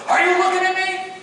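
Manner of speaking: high, loud challenge; imitated yelling. Drill instructor challenging the cadet's gaze, high mock yell. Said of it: "he starts yelling at me".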